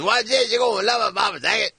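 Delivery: put-on high, slurred drunk pirate-like voice; drunk stuntman voice. Drunk stuntman talks to the camera in a high slurred voice. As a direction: play the drunk guy pitching his stunt, way up high. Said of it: "that's his voice".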